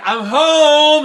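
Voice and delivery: loud, high arrival call. Acted. Jack announcing himself to his mother, calling out. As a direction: holler it through the house.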